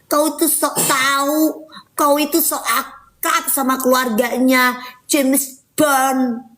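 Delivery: slow, sneering put-on voice; mocking the hater. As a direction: parody the hater who scolded her. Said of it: "langsung ngata-ngatain [name]". (meniru haters dengan nada mengejek)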